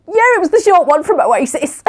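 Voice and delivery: high, giggling reply; laughing. Retold or imitated. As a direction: laugh through the words. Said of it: "And I went".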